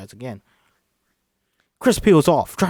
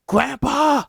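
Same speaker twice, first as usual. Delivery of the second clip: high anguished yell; 13 semitones higher, 6 dB louder than usual. Acted. Walter calling out.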